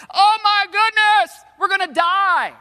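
high, loud panicked wail; panicked freak-out. Acted. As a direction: scream it out like a hammed-up disaster victim. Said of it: "starting to freak out".

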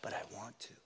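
soft then whispered; inner temptation whisper. Acted. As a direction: breathe the want at the end. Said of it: "You know".

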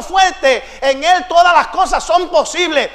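full-force preaching shout, rising to a roar; shouting preacher. Real, not acted. Preacher shouting faith to the congregation, full force. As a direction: preach it at the top of the lungs.

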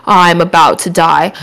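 slow, low, mock-exhausted complaint; a touch of drained weariness; retold exhaustion. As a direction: act lightly worn out, dragging the words. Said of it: "I was so tired".

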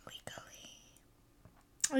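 slow, secretive whisper; whispered aside. Pretend. Joking aside about piracy.

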